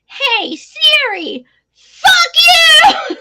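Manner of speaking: put-on high, loud little-kid yell building to a shout; gleeful kid shouting at Siri, voice leaping up; imitating Edward. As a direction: holler it high like a cheeky child. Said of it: "we were on the phone and he said".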